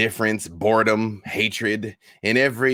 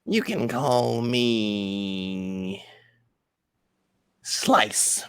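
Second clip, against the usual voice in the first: very slow, drawn-out creature voice; menacing goblin; much slower than usual. Acted. Goblin gives Xander his name, slow and menacing. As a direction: stretch it out, then hiss the name.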